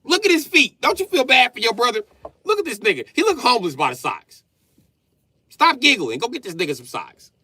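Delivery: high, loud scolding yell; dad yelling at daughters to share socks. Retold or imitated. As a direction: shout them down for teasing their brother. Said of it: "I be like".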